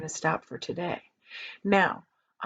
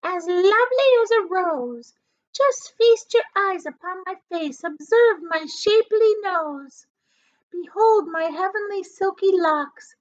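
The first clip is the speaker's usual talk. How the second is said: put-on high, loud, vain old aunt; shrill-voiced boastful aunt; Aunt Sponge's voice; 10 semitones higher, 5 dB louder than usual. A narrator's reading. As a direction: preen like a conceited hag. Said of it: "Aunt Sponge declared".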